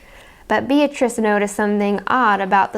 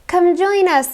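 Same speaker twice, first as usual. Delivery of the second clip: bright, higher, calling out; cheerful invite; 8 semitones higher, 4 dB louder than usual. A narrator's reading. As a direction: call her over happily. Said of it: "said Millie".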